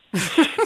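high, rising real laugh; a quick, delighted laugh at the twist; short, high-pitched laugh; burst of laughter. Real, not acted. Listener laughs at the story.

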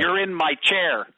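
loud, slow, each word weighted; retold line punched out slowly and loud; emphatic retort. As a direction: land the line loudly and deliberately as a retold reply. God's retort in a retold story.

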